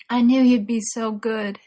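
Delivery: loud, groaned words of pleasure. A narrator's reading. Merlin praising his lover, groaning loudly. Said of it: "he groaned".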